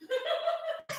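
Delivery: high unvoiced cackle; high breathy laugh. Real, not acted. Laughing at his own joke to the listeners.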